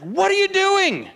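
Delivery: high, loud mock shout at another driver; indignant road-rage yelling, hammed up. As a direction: yell at the car that cut you off, half in jest. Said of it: "You're like".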